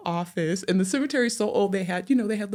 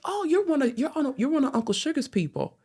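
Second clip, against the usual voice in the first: imitated high-pitched voice of a relative; Maury; 9 semitones higher than usual. Cartoonist cousin placing the caller among Uncle Sugar's people, high-pitched. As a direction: pitch it high, recognizing kin on the phone. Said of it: "Maury has this kinda high-pitched voice".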